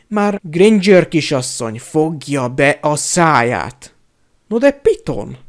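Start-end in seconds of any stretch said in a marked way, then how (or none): 4.5-5.4 higher, indignant protest. A narrator's reading. Indignant, higher protest. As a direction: minister protests to Snape. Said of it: "méltatlankodott Karamell".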